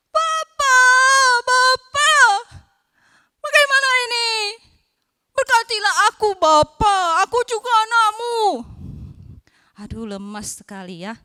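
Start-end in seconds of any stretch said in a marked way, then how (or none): 0.1-8.4 loud, much higher crying shout; crying plea. Acted. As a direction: wail and beg through tears. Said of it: "Esau dia menangis".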